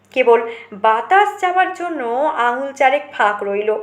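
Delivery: ordinary delivery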